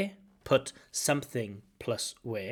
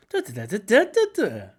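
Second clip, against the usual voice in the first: much higher and louder bouncing da da; happy intonation; 9 semitones higher, 9 dB louder than usual. Shown as a demonstration. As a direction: lift the melody up with joy. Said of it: "something happy".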